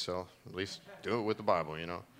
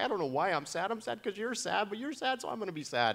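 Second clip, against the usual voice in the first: high, childlike imitation of his daughter; a lightly voiced daughter explaining her sadness; little girl voice; 9 semitones higher than usual. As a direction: play a sweet, earnest little girl. Imitating his eight-year-old daughter.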